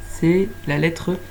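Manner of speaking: ordinary talk, nothing marked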